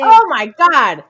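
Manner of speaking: loud, high, thrilled exclamation; excited gushing. Real, not acted. Co-hosts gushing over sexy Wes, excited exclamation. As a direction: burst out swooning over him.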